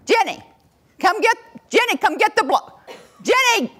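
high calling out across the room; holler from a distance. Shown as a demonstration. Parent calling across the room to a distracted child. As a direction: call her name from far off. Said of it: "how many of us holler from a distance".

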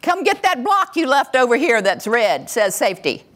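high calling out across the room; holler from a distance. Shown as a demonstration. Parent calling across the room to a distracted child. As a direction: call her name from far off. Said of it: "how many of us holler from a distance".

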